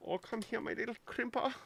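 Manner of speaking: high, quick coo; playful cooing. Pretend. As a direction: coax it like a little pet. Cooing at a little crimper.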